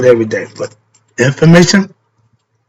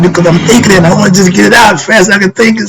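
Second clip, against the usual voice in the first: fast, a little higher, words tumbling out; rapid-fire talking; 5 semitones higher, much faster than usual. Retold or imitated. Explaining to a friend why he talks so fast, words racing. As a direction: rush it out as fast as the thoughts come. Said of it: "Why you talk so fast?".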